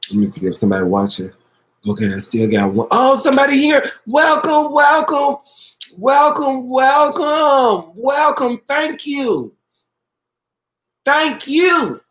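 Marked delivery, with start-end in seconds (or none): 2.9-12.0 high-pitched, delighted welcome with falling calls; excited greeting. Real, not acted. Host spotting the first viewers arriving, excited. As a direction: sing out each welcome, pitched way up.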